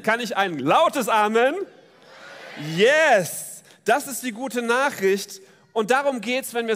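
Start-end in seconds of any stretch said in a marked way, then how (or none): 2.8-3.6 loud, jubilant yes shouted to the congregation; excited shout. Real, not acted. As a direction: cheer it out to rouse the room. Crowd asked for loud amen. (begeisterter Ausruf an die Gemeinde)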